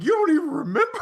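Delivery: hammed-up high, loud wail; mock crying Chun-Li. Chun-Li outraged that Bison forgot, mock crying. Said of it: "And she was just like".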